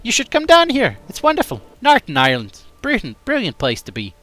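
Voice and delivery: high, loud, sing-song Cork accent; bright exaggerated Cork lilt. Shown as a demonstration. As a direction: gush about Cork like a local. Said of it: "I think that's all right".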